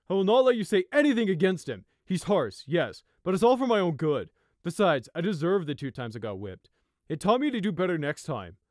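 loud, heated defending; defensive anger. Acted. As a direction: defend his mentor fiercely. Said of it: "with a careless shrug".

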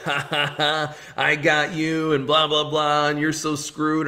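lightly voiced gloating villain, sing-song; gloating gunman. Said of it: "the guy just starts talking".

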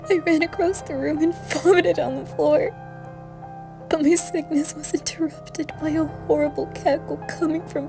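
acted distress. Sickened parent hearing a cackle behind them, distressed. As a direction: keep the panic up in the voice.

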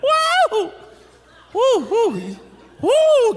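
very high, loud laughing woo-hoo; high hammed-up whoops of delight; excited whoop. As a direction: whoop at the Instagram photo like it is a thrill. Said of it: "you be like".

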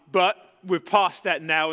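usual speaking voice

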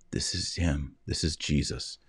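a soft, awed murmur; hushed recognition. Acted. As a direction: quietly realize who he is. Mary's inner recognition, hushed.

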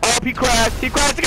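high, thrilled crowing; gleeful excitement. Real, not acted. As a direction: crow over a rival's crash. Cheers a rival's crash.

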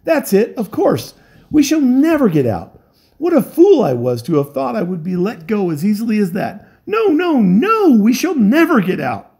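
high, loud, mad despairing voice; a broken man laughing at his doom; hysterical stranger. Acted. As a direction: stranger raving to the terrified crew.

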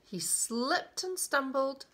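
drawn-out, emphatic storybook reading; slow, dramatic storyteller; story narration. A narrator's reading. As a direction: stretch the key words for small listeners. Reads a picture book aloud.

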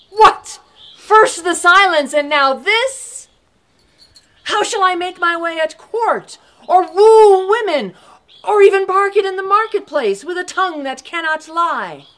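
loud, indignant outburst; outraged protest. Acted. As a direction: throw the questions at her in disbelief. Thomas outraged at the gift.

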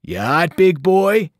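sneering tough-guy taunt; mocking trucker. A narrator's reading. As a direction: jeer at the caged lion. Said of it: "he asked the lion".

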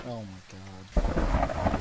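exasperated. Real, not acted. Slow low groan.

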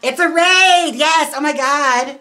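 loud, high burst of real excitement; excited cheer. Real, not acted. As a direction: cry out in delight, loud and high. Excited reaction to stream raid.

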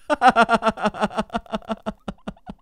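breathless high giggle; high rising laugh. Real, not acted. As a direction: dissolve into laughter. Laughing at vomiting.

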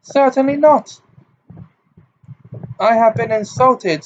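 proud hurt spilling over; throbbing with emotion. A narrator's reading. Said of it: "in a voice throbbing with emotion".

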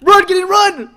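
urgent shouts, running for his life; being chased. Real, not acted. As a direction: yell at your friend to run.

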